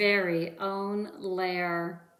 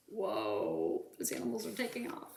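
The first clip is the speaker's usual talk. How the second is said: low startled whoa; 3 semitones lower, 8 dB quieter, much faster than usual. Acted. Aside as animals flee.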